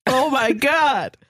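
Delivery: ordinary delivery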